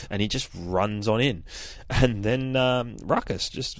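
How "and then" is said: normal talking voice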